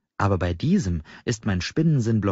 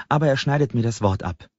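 usual speaking voice

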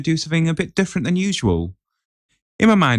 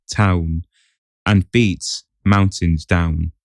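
measured, dramatic reading of riddles; riddle recital; 4 semitones lower, slower than usual. Acted. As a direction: read each riddle like a spell. Reads riddles aloud.

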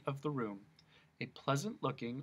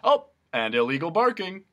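policeman voice; 6 semitones higher, 11 dB louder than usual. Acted.

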